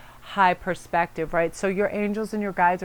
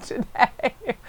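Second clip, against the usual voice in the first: slow, higher put-on husband voice, laughing; incredulous husband; 6 semitones higher than usual. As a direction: imitate him baffled. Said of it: "And Ian's like".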